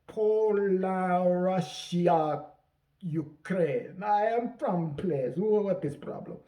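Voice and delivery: slow, halting fake Eastern European accent; mock Eastern European accent. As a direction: fumble for a country in a bad Eastern European accent. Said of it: "Hilaria Baldwin School of Accent".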